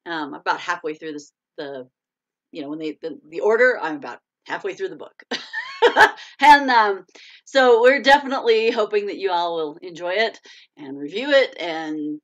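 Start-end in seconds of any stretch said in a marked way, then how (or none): 5.3-6.3 short, high, loud laugh. Real, not acted. Host laughing at her muddled explanation. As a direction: let out a quick peal of laughter.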